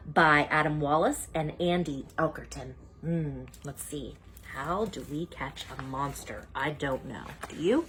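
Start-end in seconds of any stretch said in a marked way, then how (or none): 3.0-7.9 hushed, puzzled musing; soft wondering. Acted. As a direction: wonder aloud softly. Wondering to child.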